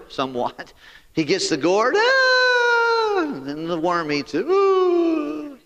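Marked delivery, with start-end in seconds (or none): 2.0-3.2 high, loud cry of despair; Jonah's high, loud wail of loss; anguished cry. Acted. Acting Jonah's dismay. 4.5-5.6 high, loud drawn-out groan; mock groan over the lost gourd; pained ooh. Jonah's gourd eaten by worm.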